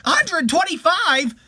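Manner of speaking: high, loud, slow disbelieving echo; astonished. Acted. Wife repeats the impossible age in astonishment. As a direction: stretch it out in amazement.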